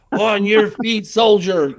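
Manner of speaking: mock army command to a worn-out hiker; drill sergeant order. Ordering exhausted friend up mountain.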